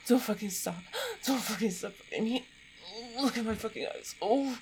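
urgent pleading. Acted. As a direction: plead through the peak of pleasure. Climax of intimate performance.

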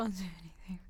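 breathy whisper. Real, not acted. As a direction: whisper it urgently. Pleading in whisper.

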